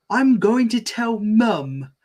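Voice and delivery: put-on high, slow whiny child; little kid voice. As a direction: whine it like a small girl. Bingo tattling.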